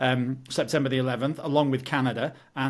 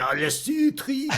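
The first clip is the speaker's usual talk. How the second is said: much higher, slow, nasal mock Quebec French accent; 11 semitones higher, slower than usual. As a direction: send up a Quebec French speaker, right in the nose. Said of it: "probably right in the nose".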